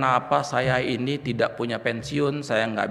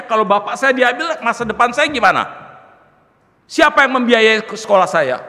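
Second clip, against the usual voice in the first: loud, high, pleading questions building in force; anguished protest; 10 semitones higher, 9 dB louder than usual. Retold or imitated. Son protesting to God over his dying father, anguished protest. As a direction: retell a desperate protest, growing louder.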